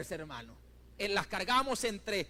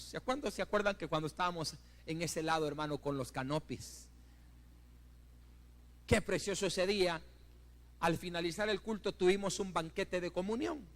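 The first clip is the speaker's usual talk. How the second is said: ordinary talk, nothing marked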